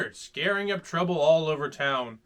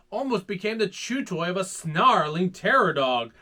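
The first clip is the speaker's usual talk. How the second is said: plain reading voice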